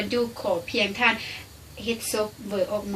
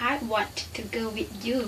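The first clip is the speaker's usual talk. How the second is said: slow demo; 8 dB quieter, much slower than usual. Shown as a demonstration. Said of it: "คำนี้".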